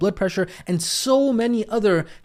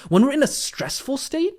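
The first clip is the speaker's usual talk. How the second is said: higher, tense stressed voice; 8 semitones higher than usual. Shown as a demonstration. Coach demoing a stressed tone to Tina. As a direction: sound stressed on stressful state. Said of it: "when I said stressful state".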